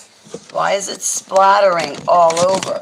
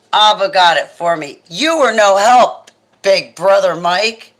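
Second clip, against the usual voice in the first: playful scolding; 3 semitones higher, 8 dB louder than usual. Real, not acted.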